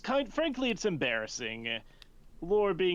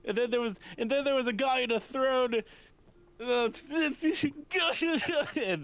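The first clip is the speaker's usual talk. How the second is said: high, tearful babble; blubbering; 11 semitones higher, 3 dB louder than usual. Acted. Aurora babbling about the Feywild, sobbing. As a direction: pile it up until words give out. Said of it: "just embarrassing".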